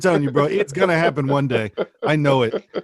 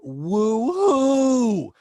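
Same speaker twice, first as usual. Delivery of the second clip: mock woo-hoo yell, higher; retold yell; 7 semitones higher, 3 dB louder than usual. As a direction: imitate a party woman's whoop. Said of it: "she yelled out".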